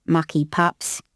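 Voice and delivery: grumbled under the breath; a hint of a grumpy beefeater; muttering beefeater. A narrator's reading. Beefeater grumbling at litter louts, muttered. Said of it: "He was muttering to himself".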